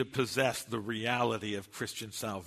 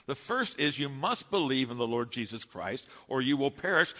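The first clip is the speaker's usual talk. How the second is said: ordinary talk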